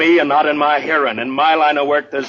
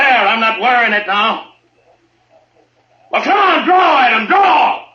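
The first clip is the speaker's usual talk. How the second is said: high, loud, daring the crowd; furious lawman shout; angry challenge; 12 semitones higher, 5 dB louder than usual. Acted. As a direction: taunt them to draw on you. Marshal challenges the mob.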